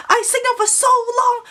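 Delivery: put-on high-pitched whine in a Chinese accent; Chinese-accented yelling. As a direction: mimic her shrieked complaint, Chinese accent and all. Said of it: "very heavy Chinese accent".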